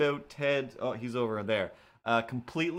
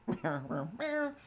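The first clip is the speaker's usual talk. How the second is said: meowing cat; 5 semitones higher, 4 dB quieter, slower than usual. Pretend. Cat voice again.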